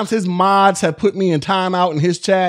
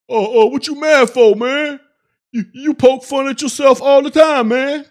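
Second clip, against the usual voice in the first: mocking chatter; 4 semitones higher, 4 dB louder than usual. Imitating chatter brushing off offence.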